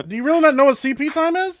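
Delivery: high, loud, laughing disbelief. Real, not acted. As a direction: laugh in disbelief as you ask. Amazed someone doesn't know.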